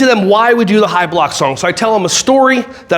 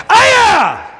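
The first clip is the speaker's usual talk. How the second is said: high, sudden karate cry; chorus yell; 11 semitones higher than usual. Shown as a demonstration. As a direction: fire off a high-pitched battle yelp. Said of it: "the aya is the chorus".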